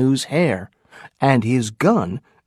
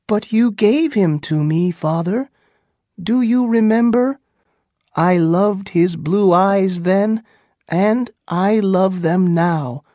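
put-on higher pleading young woman; pleading daughter; 6 semitones higher than usual. A narrator's reading. Pocahontas pleads with her father, higher pleading.

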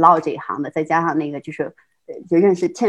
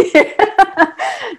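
high burst of laughter; 14 semitones higher, 4 dB louder than usual. Real, not acted. Laughs at own story.

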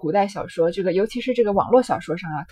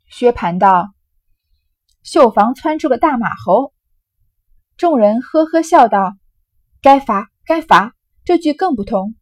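loud, lively storytelling voice acting out a drinking game; dramatic novel reading; 6 dB louder than usual. Teacher reads the drinking-game scene aloud, loud and lively. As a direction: read the scene with relish, loud and animated.

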